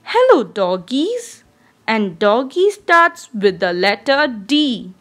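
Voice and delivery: ordinary talk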